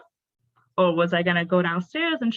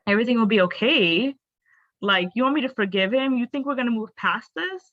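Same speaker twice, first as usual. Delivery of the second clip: higher questioning disbelief; incredulous echo; 4 semitones higher than usual. Retold or imitated. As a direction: throw the words back as an indignant question. Throws mother-in-law's words back in disbelief.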